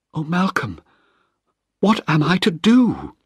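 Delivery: mild tearful helplessness; tearful plea. Acted. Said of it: "She started to cry".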